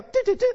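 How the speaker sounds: high-pitched little "do-do-do" key taps; tiny high sing-song beeps; imitated button presses. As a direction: mimic effortless tapping in a high voice. Imitating quick computer fix.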